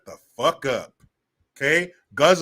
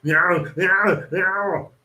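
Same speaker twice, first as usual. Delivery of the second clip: loud, higher strangled yowls; imitated choking cries; 5 semitones higher, 6 dB louder, much slower than usual. Hero unable to breathe on Mars, imitated choking cries. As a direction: cry out in hammed-up airless gasps. Imitates gasping scene from Total Recall.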